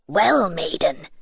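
low, drawn-out King Frost voice; slow, low and imperious, snapped at the maiden; snapping frost king. A narrator's reading. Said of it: "he snapped out".

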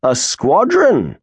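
incredulous slow echo of squadron; surprised echo. Acted. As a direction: repeat squadron back as if you can't believe it. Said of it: "echoed Grimes".

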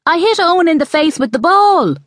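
put-on high-pitched boy's yell; child yelling. A narrator's reading. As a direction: yell the confession high to mother. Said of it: "Mark yelled".

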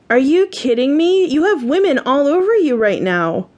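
acted exasperation without shouting; indignant outrage. A narrator's reading. Wife confronts husband at party.

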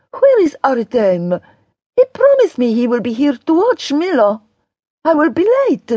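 high character voice, worried about time; high-pitched complaint about being late; anxious Daria. A narrator's reading. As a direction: fret in Daria's high, insistent voice.

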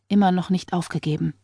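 ordinary delivery

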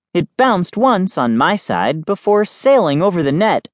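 plain reading voice

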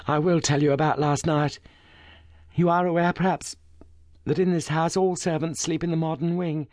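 a shaken noblewoman holding together; refined distressed lady. A narrator's reading. Lady Brackenstall voiced in story.

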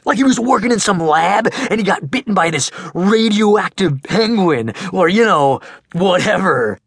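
put-on high, loud goofy boy voice; big teasing kid. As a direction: tease your friend loudly, laughing it off. Max rambling.

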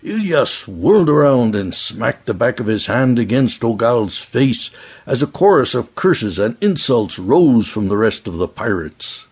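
ordinary delivery, nothing marked

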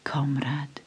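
soft, unvoiced whisper of recognition. A narrator's reading. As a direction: breathe the word comrade almost silently. Narrator whispers the greeting.

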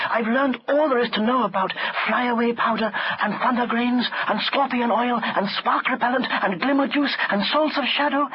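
high, breathless-paced list of grievances; frustrated girl. A narrator's reading. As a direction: pour it out to a friend. Leela complains.

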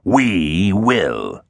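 drawn-out, ominous answer; mystery villain. A narrator's reading. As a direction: hidden villain stretching each word. Hidden villain answers in echoing voice.

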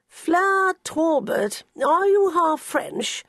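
put-on high, very slow, mannered old gent; a distinguished old man sizing her up; elderly gentleman. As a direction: Sir Quentin, slowly and grandly, to Fleur. Sir Quentin questions the new secretary.

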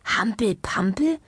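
slow, wondering question with rising end; puzzled. A narrator's reading. Patient wondering at the doctor's odd sport, puzzled. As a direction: echo "Hampel-Pampel" in disbelief, lifting the end. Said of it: "dachte Herr Ombovesi verwundert".

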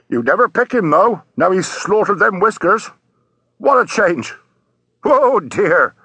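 high-pitched, emphatic sailor voice; ship's sailor. Acted. Sailor answering a policeman from the deck, emphatic. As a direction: call down firmly from up at the rail.

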